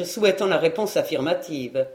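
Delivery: plain reading voice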